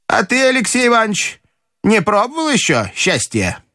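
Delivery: high-pitched inquisitive grandmother; бабушка расспрашивает. Acted. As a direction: quiz him in a high old-lady voice. Grandmother questions the narrator. (высокий голос любопытной бабушки)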